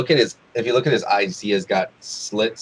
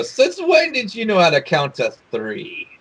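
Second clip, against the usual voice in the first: higher mocking captain voice; 10 semitones higher than usual. Captain mocking Sambalet, mild high taunt. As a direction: tease the impostor. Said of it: "He's gonna go".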